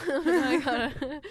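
laughing. Real, not acted.

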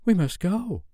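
put-on high, lightly bright girl's voice; almost bright. A narrator's reading. Wendy not understanding the danger, almost brightly. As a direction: pitch it up, nearly cheerful. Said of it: "she said almost brightly".

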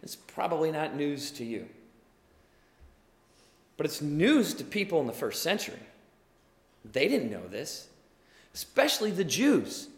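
usual speaking voice

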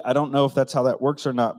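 normal talking voice

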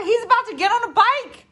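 loud, very high urging; hammed-up frantic plea; frantic excitement. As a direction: shout it in high-pitched excitement. Recalls urging bored kids to watch.

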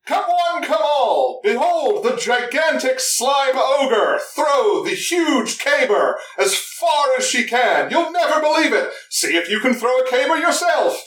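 high, booming showman's call; carnival barker. Acted. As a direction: bellow it like a fairground barker. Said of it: "full carnival barker".

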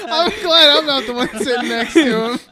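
laughing. Real, not acted. Friend joking about sitting beside the teller, laughing. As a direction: talk while cracking up.

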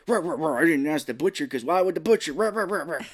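growling "rah rah" like an angry dog; mock growling grumble, half laughing; Tim Allen grumbling. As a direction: grumble in barks like a grouchy husband. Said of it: "He just talked like that".

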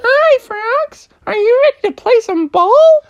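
high, friendly little kitten; sweet kitten voice. Acted. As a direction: chirp it like a tiny cat. Kittens greeting the frogs.

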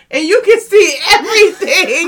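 can't stop laughing while talking; giggling speech. Real, not acted. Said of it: "laughing through talk".

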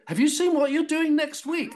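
high-pitched, agog imitation of makeup girls; excited gossip. Makeup girls asking the actor about next week, excited gossip. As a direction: mimic someone bursting with news, voice way up.

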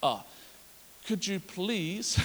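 put-on soft, slow polite child; quiet mock child asking properly; child asking nicely. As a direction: ask sweetly and slowly. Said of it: "child asking politely".

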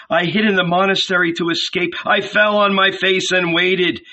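burn with conviction as you tell it; fervent Jesus. A narrator's reading. Jesus voiced, fervent testimony.

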